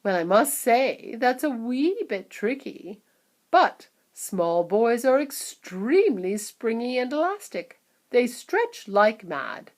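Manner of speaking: slow, musing; thoughtful. A narrator's reading. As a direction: ponder aloud. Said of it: "gazing thoughtfully at the ceiling".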